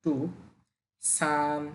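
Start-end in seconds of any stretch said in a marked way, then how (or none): 1.0-1.6 সান held long on one level pitch; Chinese first tone. Shown as a demonstration. Teacher showing learners a drawn-out first tone. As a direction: draw out সান evenly. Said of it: "first tone টেনে বলতে হবে".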